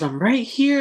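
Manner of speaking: high, loud indignant cry; startled protest. Retold or imitated. Nephew protesting to his uncle, high and startled. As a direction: insist you're here, shocked.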